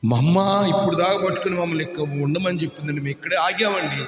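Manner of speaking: soft, low, hesitant telling; quiet little-girl report; timid girls' account. Acted. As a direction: speak as frightened abandoned children. Voicing abandoned girls.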